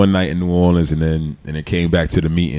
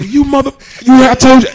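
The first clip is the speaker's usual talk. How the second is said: very high, loud exploding retort; furious shout; 8 dB louder than usual. Retold or imitated. Rookie yelling back at the veteran on the plane, furious. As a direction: blow up at him, full rage.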